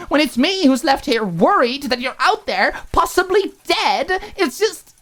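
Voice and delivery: exasperated roommate venting; exasperated anger. Acted. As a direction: rattle it off, fed up. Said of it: "exasperated laugh".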